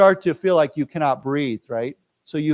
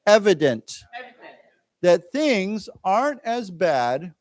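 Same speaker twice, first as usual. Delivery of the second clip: slow, deliberate reading for learners; teacher reading a news text to students; slow read-aloud; 3 semitones higher, slower than usual. Acted. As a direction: read it out slowly with long pauses.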